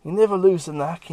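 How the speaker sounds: pleased tough guy. Acted. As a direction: brag from the floor with a grin. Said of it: "on the floor going".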